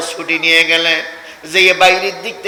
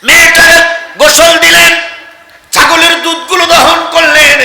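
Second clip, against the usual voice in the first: high, loud sermon chant; full-force waz chant; impassioned preaching cry; 11 semitones higher, 6 dB louder than usual. A narrator's reading. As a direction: cry it out high to the crowd, drawing out the vowels. Preacher proclaims the acts of care.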